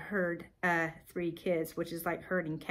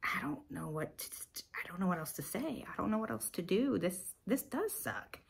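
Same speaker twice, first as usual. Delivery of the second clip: helpless prayer; 7 dB quieter than usual. Retold or imitated. Mom praying while her son keeps crying, quietly helpless. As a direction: say it quietly, at a loss.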